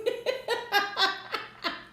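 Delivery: high airless laugh; amused breathy laughter. Real, not acted. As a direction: let the laugh escape. Laughing at the card reply.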